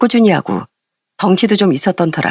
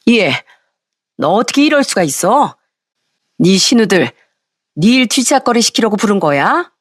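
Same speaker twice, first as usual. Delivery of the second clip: indignant mother-in-law, not really shouting; angry scolding; 4 semitones higher than usual. A narrator's reading. Mother-in-law confronting daughter-in-law over the chores, angry. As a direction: berate her in outraged questions, voice lifting in pitch. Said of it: "쩌렁쩌렁 화를 내기 시작했습니다".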